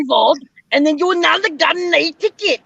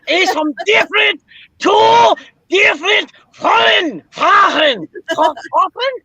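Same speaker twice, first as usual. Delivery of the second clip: put-on Schultz-style German accent shouting at the coins. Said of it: "you sound like Schultz from".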